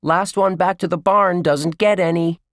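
high, loud racing challenge; excited shout. A narrator's reading. Josh dashes off to race his friends, excited shout. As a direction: call back to friends as you run.

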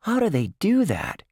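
put-on high wondering kid voice; puzzled and amazed. A narrator's reading. Josh amazed at ventriloquist.

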